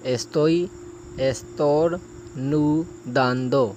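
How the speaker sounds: Estoy estornudando broken into slow, stretched syllables; slow it right down so learners can follow; slow practice take. Shown as a demonstration. Teacher slowly breaks down a tricky phrase for practice. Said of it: "یہ فقرہ تھوڑا مشکل ہے".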